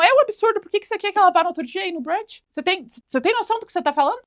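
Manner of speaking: high, fast, whiny crew-member voice; a fawning yes-man piling on, high and rushed; mocking sycophant. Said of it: "Um até concorda com ela". (membro da equipe puxa-saco concordando, voz aguda e rápida)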